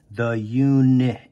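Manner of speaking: uni- drawn out slowly; sounding out. Shown as a demonstration. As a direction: stretch the start of unicorn. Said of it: "stretch out the word unicorn".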